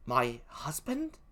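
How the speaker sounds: slow, soft, rising question; stunned question. Acted. Emilia hears her husband knew, stunned. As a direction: ask it quietly in disbelief.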